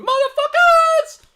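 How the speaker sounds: high, loud, stretched-out yell; excited yell. Real, not acted. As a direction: belt it out at the viewers. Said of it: "Sorry for the language".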